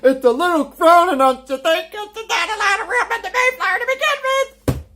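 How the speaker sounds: high, mocking imitation of a pilgrim. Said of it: "You say".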